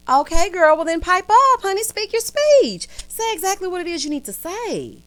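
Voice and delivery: high, loud, sassy cheerleading; hyped-up egging on. Acted. Viewer tells Karen to pipe up, hyped-up and high.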